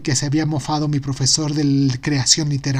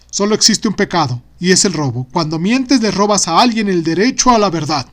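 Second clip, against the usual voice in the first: stern father; 3 semitones higher, 6 dB louder than usual. Acted. Father's lesson quoted in story.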